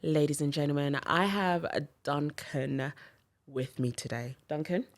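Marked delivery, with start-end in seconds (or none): none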